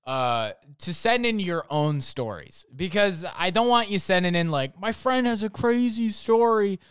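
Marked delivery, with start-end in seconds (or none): 4.8-6.8 high, put-on voice of an eager fan; mocking a fan. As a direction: parody someone passing on a friend's tale. Mocks viewers' secondhand submissions.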